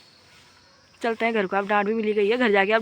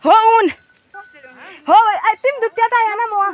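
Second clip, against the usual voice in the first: loud, high call across the hills; 10 semitones higher, 7 dB louder than usual. Real, not acted. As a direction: shout it to someone far off. Calling out to someone far away.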